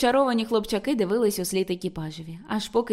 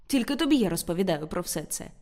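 ordinary delivery, nothing marked

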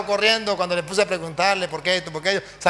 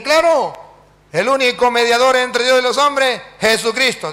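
loud, raised proclamation; emphatic answer; 5 semitones higher, 4 dB louder than usual. Acted. Priest answers the pastor before everyone, loud and certain. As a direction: answer with full-voiced certainty.

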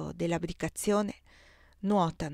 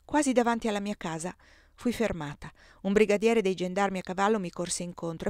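ordinary delivery

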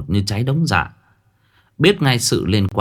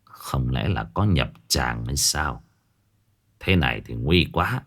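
muttering to himself; 7 semitones lower, 5 dB quieter than usual. Acted. Old master mutters to himself over the bitten chickens, quiet. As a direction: worry it out under your breath. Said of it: "Lão lẩm bẩm".

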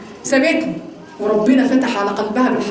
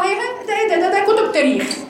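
higher-pitched, dismissive mockery; mock scorn; 6 semitones higher than usual. Disbelievers scoffing at the Quran, lightly acted mockery. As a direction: play a scoffer waving it away, voice lifted. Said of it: "بدأنا بقى في الاستهزاء".

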